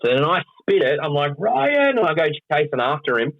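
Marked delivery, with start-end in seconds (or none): 1.4-2.0 high, drawn-out name call; acted angry yell. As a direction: yell after a fleeing prankster, in mock outrage. Yelling after prankster in acted story.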